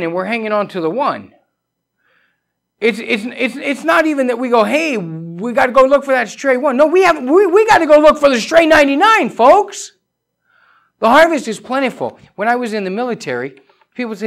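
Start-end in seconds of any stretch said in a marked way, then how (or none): 2.8-12.2 loud, high, driving sermon voice; full-force preaching. Acted. Preacher urging his flock to seek the lost, full-force preaching. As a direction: hammer it home from the pulpit.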